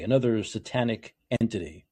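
normal talking voice

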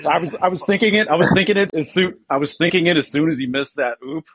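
loud excited rush. Real, not acted. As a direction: blurt it out eagerly. Excited telling, laughing crowd.

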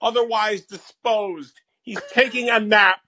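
measured, odd pauses like Malkovich; Malkovich bit. Pretend. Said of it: "John Malkovich, is that you?".

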